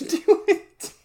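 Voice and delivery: ordinary talk, nothing marked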